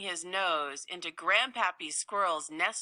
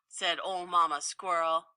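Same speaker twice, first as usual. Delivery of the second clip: plain reading voice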